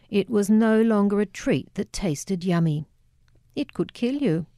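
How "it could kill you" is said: usual speaking voice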